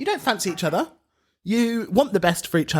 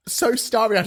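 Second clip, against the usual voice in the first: normal talking voice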